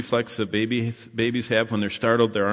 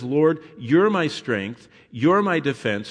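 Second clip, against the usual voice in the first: slow, louder proclamation; preaching; 4 semitones higher, 5 dB louder, slower than usual. Acted. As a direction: declare it with conviction.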